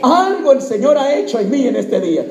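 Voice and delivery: full-voiced proclamation to the congregation; confident, projected pulpit declaration; preacher's declaration. Shown as a demonstration. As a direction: declare it out for everyone to repeat. Preacher models line for congregation.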